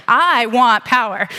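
slowly: I want power; slow deliberate declaration. Real, not acted. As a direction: lay it down slowly, tongue in cheek. Comic declaration, slowed down.

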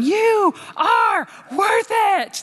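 high, slow, shouted encouragement from the friend; a small friend bellowing you are worth it; friend fired up. Retold or imitated. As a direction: cheer her on at full volume. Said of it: "her going".

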